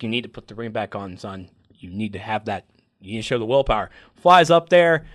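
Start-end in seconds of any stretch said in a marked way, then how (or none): 0.0-3.9 low, soft fatherly urging; lightly voiced dad telling his son to put the ring back; a hint of a stern father. Hal's father urging him on, low and soft. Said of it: "He's like".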